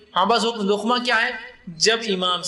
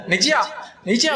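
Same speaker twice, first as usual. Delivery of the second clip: calling down; 4 semitones higher than usual. Retold or imitated. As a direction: order the imam down. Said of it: "اس کو نیچے بلاتے". (نیچے آ زور سے پکارتے ہوئے)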